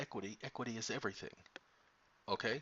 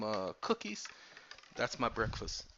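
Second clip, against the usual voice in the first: normal talking voice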